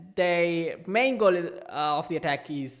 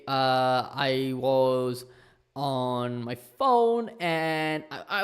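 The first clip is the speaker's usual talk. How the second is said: usual speaking voice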